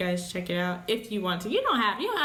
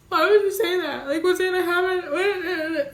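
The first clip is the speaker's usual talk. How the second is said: gasp, then high, loud mock-distressed wail; alarmed protest; 11 semitones higher, 7 dB louder than usual. Partner raises dying, she answers in loud high distress. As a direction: wail it in upset disbelief. Reacting to partner's morbid what-if.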